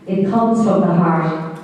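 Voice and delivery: ordinary delivery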